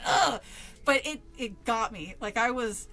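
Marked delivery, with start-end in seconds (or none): 0.1-0.4 loud, high fed-up groan; disgusted groan. Retold or imitated. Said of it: "it still pissed me off".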